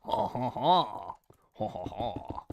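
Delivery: slow, drawn-out villain laugh in French style; French evil laugh. Shown as a demonstration. Streamer showing how to unlock French mode, French evil laugh. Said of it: "Do the French evil laugh".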